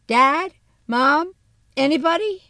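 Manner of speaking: loud, high calling out. A narrator's reading. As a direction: call out to the whole house. Calling out through the house.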